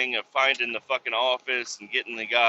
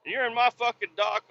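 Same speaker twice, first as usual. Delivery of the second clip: mocking the other driver; 10 semitones higher than usual. Imitating the other trucker griping about the dock door, high and mocking. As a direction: pitch it way up to mock his complaint. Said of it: "He's like".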